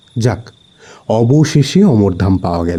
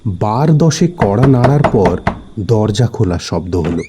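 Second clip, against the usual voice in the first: usual speaking voice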